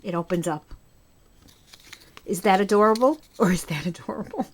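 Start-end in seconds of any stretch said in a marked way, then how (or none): 3.4-4.5 quieter, lower, cooed to herself; soft cooing repeat. Shown as a demonstration. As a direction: coo it softly as if to a baby. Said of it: "repeats the question twice".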